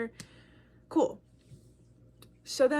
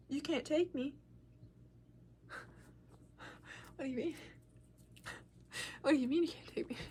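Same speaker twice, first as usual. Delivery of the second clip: hushed, halting questioning; small, quiet voice of retold disbelief; acted stunned disbelief; 3 semitones higher, 12 dB quieter, faster than usual. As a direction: softly repeat it back as if stunned. Stunned reply to mom, acted.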